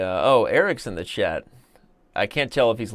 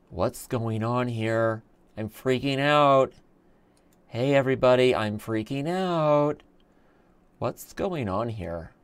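put-on slow, drawn-out little-kid voice; six-year-old daughter; slower than usual. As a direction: imitate a small child, stretching the words. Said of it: "my six-year-old daughter".

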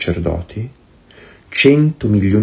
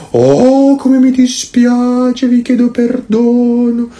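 mock groveling apology. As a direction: whine the apology like a spineless people-pleaser. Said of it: "noi avremmo detto".

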